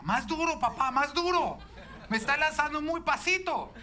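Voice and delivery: put-on high, loud demanding child's yell; child on a swing shouting at dad to push harder; imitating furious child. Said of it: "empezó a gritar furioso".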